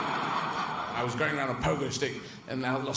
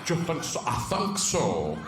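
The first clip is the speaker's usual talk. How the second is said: slow, deliberate mock Russian accent; dubious Russian accent; slower than usual. Russians discussing a pointless moon trip, mock Russian accent. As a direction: put on a wandering Russian accent. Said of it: "But with a Russian accent".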